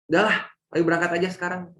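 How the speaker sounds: angry dad rushing his family out, fast and loud; angry father. Retold or imitated. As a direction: hurry them out the door, annoyed. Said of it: "Marah, berangkat sambil marah-marah".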